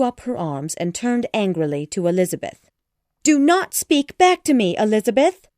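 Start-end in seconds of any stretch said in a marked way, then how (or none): 3.2-5.5 high, loud scolding mother; angry cry. A narrator's reading. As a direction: snap at the daughter, voice raised high. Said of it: "she cried".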